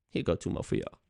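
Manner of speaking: hushed, low throwaway delivery; mock offhand offer. Imitating the rapper adding songs, hushed offer. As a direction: toss it out under the breath. Mocking retold album announcement.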